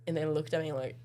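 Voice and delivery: ordinary talk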